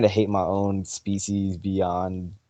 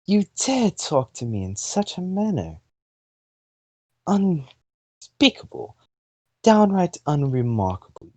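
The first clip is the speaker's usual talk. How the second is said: haughty British fire goddess, higher pitched; British accent; 4 semitones higher than usual. Acted. Fire goddess scolds the human who dared talk back, offended British accent. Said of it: "giving her a British accent".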